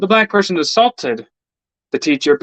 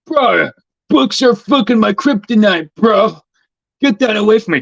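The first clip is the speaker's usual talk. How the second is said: higher, altered bro voice pushing the book away; mocking student; 6 semitones higher than usual. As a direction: sneer like a kid who hates books. Imitating a student refusing books.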